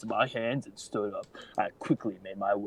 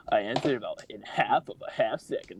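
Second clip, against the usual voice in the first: ordinary delivery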